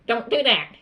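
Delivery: usual speaking voice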